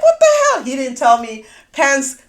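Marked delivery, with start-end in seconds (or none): 0.0-0.6 very high, loud laughing what the hell; laughing disbelief. Retold or imitated. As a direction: laugh out the shock. Retelling her shocked reaction, laughing.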